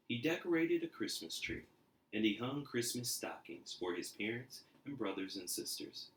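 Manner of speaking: lively storybook reading; animated read-aloud for kids; story narration. A narrator's reading. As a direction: read it like a bedtime picture book. Reading picture book aloud.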